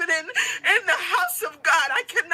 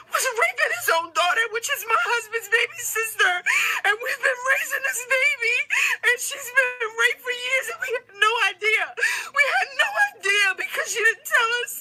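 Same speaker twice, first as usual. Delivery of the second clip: high crying shout, rushing at the end; devastated crying; 5 semitones higher than usual. Real, not acted. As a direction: sob the words out, heartbroken. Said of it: "And I'm so devastated".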